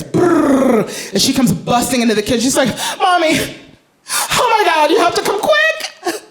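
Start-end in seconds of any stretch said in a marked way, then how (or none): 3.0-6.2 put-on high, frantic child's voice; a scared kid yelling for mom; panicked little girl. Little daughter running to mom, high and frantic.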